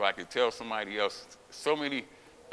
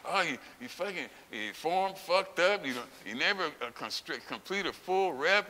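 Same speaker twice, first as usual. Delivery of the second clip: mimicked complaining; 7 semitones higher than usual. Critics complaining about the lifter's form, mockingly imitated. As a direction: imitate fussy critics, pitch up, words stretched. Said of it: "they complain about me all the time".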